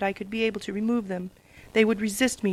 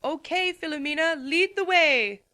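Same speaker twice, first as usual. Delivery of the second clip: higher, slow call up to the bird; shouted up; 7 semitones higher, slower than usual. Acted. Celestia calling up to her pet bird, shouting. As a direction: call out to the bird flying above. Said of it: "Princess Celestia shouted up".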